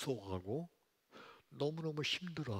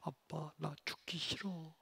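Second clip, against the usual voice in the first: trembling plea; 5 semitones higher, slower than usual. Retold or imitated. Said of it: "무서워서 떨면서".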